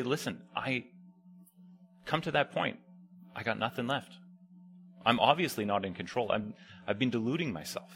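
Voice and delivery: soft, low prayer of giving up; quiet confessional whisper-like voice; hushed confession. Pretend. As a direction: murmur it like a humbled prayer. Quoted prayer of surrender.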